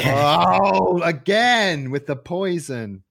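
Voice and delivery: high, drawn-out wail of complaint; hammed-up dismay, crying out; mock exasperation. Shopper landed with cat poison again, mock exasperation.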